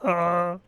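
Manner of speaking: mock creature roar; imitated Chewbacca roar. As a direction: roar back a wordless yes like Chewbacca.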